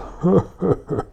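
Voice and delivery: a high booming robber laugh; gloating laugh. Acted. Robber laughing from the window at the trapped friends. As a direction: laugh down at them in triumph.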